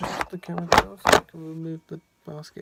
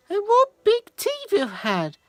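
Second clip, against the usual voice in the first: put-on girl's voice; 12 semitones higher than usual.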